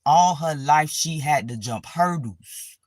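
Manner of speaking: slow solemn epitaph; mock eulogy. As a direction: intone it like a tombstone line, slowly. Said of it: "God put this shit on my obituary".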